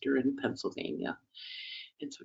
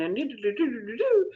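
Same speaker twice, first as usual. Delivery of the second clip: gossip chatter; 10 semitones higher than usual. Retold or imitated. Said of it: "busy gossiping".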